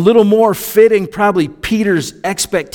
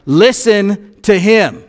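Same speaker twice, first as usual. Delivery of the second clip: "Listen to him" slow and a bit louder, each word weighted; emphatic command; 3 semitones higher, 3 dB louder, slower than usual. Shown as a demonstration. Preacher showing the congregation the command in God's words, slow and emphatic. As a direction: land "Listen to him" like an exclamation. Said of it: "big old exclamation point on the end".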